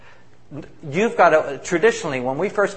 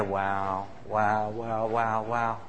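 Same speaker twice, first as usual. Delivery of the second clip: awed wows; 5 semitones lower, 6 dB quieter, much slower than usual. Retold or imitated. Speaker recalls hearing testimony.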